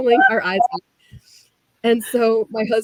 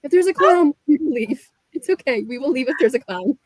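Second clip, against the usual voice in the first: reassuring husband; 5 semitones higher than usual. Retold or imitated. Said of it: "He's like".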